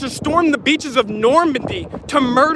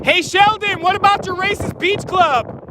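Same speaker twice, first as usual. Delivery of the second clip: high, raised call across a crowd; calling from far away; 5 semitones higher than usual. Real, not acted. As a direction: call out to him from a distance. Protester calling out to a politician.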